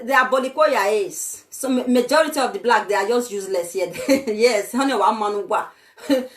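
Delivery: ordinary talk, nothing marked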